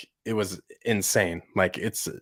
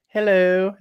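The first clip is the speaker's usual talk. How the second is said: high, loud put-on character voice; 11 semitones higher, 7 dB louder than usual. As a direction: squeak it out like the cartoon role. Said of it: "Do the voice".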